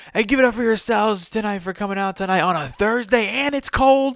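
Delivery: high, energetic crowd warm-up; hype host. Shown as a demonstration. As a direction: welcome the crowd with a big grin. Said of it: "be like".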